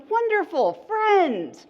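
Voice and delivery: high, loud delighted cry; thrilled to see a friend. Shown as a demonstration. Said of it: "The nervous system's like".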